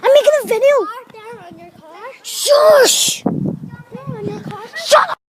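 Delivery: high, loud screamed commands; furious yelling. Real, not acted. Silencing others during a video, furious yelling. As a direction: escalate into screaming to silence them.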